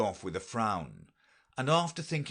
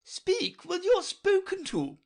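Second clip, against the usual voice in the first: high, loud sharp rebuke; 6 dB louder than usual. A narrator's reading. Red Queen snapping at Alice, loud and high. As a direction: cut her off sharply. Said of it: "the Queen sharply interrupted her".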